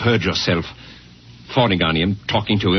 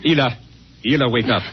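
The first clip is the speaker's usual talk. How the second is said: higher, urgent call to wake her; calling awake; 6 semitones higher than usual. Acted. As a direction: rouse her from sleep. Husband waking wife.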